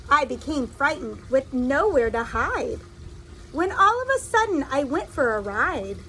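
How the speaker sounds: lively storybook reading voice; reading to children. A narrator's reading. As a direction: read it like a picture book to little kids. Reads a picture book aloud.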